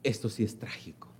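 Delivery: hushed, grave whisper; sorrowful aside to the congregation. Real, not acted. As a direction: drop to a breath for weight. Sermon drops to a whisper.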